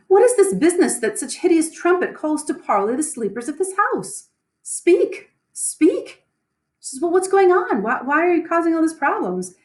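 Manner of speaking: high, lightly put-on lady's voice; a high-pitched demanding noblewoman; Lady Macbeth voice. Lady Macbeth demanding why the bell rings, in a high voice.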